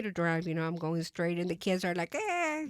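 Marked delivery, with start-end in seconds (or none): 2.1-2.7 imitated childish cheer, much higher; happy kids' squeal. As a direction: mimic happy kids in the back seat. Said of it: "they're so happy".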